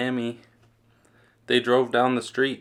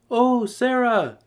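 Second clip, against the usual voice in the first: much higher, slow gushing welcome; gushing principal; 11 semitones higher, 3 dB louder, slower than usual. A narrator's reading. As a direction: pour on the sweetness. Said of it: "Missus Burton gushed".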